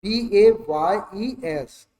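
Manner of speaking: slow letter-by-letter B A Y E S; spelled out for the class; spelling out letters. Shown as a demonstration. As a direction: spell each letter slowly and clearly. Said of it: "apostrophe ऐसा लगा दिया".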